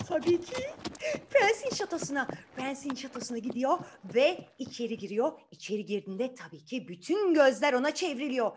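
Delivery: plain reading voice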